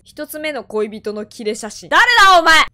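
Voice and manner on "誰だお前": hit hard crying out. Real, not acted. Startled by a ghost.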